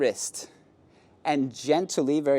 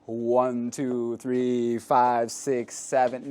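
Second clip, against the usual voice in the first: counting the steps out mechanically, a touch lower; stiff counting; 3 semitones lower, much slower than usual. Shown as a demonstration. Instructor mimicking a student who dances by counting, stiffly. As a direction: recite the count like a nervous beginner. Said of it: "it's not like".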